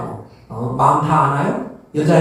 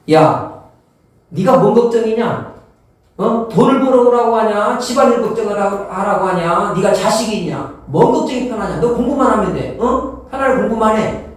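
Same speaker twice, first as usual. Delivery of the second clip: put-on a mother nagging her studying child, raised exasperated voice; scolding parent; 6 semitones higher than usual. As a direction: scold the kid like an exasperated mom.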